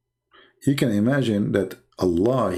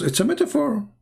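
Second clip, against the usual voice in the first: much higher, sarcastic emphasis on metaphor; mocking high repeat; 13 semitones higher than usual. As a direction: sneer it in a squeaky high pitch.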